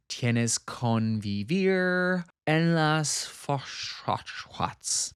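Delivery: slow, hammed-up fake Spanish; mock Spanish. Mocking the rival's toast, slow fake Spanish. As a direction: drawl out gibberish Spanish like a toast.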